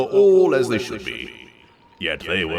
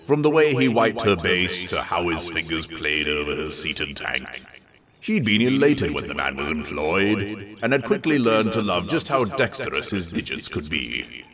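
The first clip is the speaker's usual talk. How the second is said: plain reading voice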